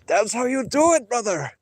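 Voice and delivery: high hammed-up wrestling hype; wrestler spirit. Wrestler's spirit cheering the streamer on, high mock hype. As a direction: cheer it like a pro-wrestling legend. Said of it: "Then he'll be like".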